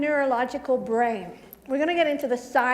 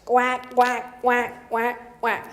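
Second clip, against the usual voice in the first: duck quacks; 4 semitones lower, much slower than usual. Pretend. Inner ducks quacking at her.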